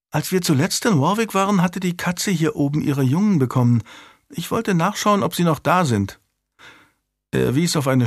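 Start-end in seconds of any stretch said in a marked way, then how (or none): 0.1-6.2 put-on high-pitched child explaining herself; young child's higher voice; little girl. A narrator's reading. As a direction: voice a small noble girl.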